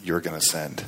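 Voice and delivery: soft, low, calm reply; still small voice. Retold or imitated. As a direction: answer gently as God's quiet voice. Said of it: "that still small voice comes in".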